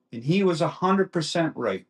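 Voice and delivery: usual speaking voice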